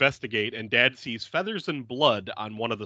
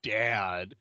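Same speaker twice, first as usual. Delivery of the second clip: low, soft mock exasperation; hushed, put-upon acted groan; eye-rolling kid; 4 semitones lower, 8 dB quieter than usual. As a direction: sigh it out like a fed-up kid. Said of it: "rolls his eyes like".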